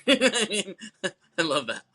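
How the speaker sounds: laughing through the words; high giggling delight. Real, not acted. Laughs while commenting on death scene.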